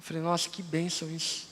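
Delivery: whispered, very soft wonder; hushed marvel. Retold or imitated. Pastor recalling meeting founder's mother, hushed in awe. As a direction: breathe it out in awe. Quiet aside remembering blessing.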